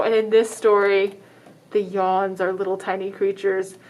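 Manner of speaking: plain reading voice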